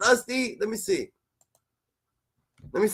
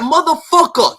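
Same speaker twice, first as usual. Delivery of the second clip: a raised, mock-furious curse; retold angry curse; 6 semitones higher, 4 dB louder than usual. Retelling Wack's angry call, a mock-furious curse. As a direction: spit out the insult like he's offended. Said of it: "He was so serious".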